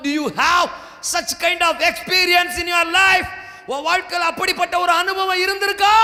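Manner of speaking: demanding sermon shout; preaching at full force. Acted. Preacher challenges congregation.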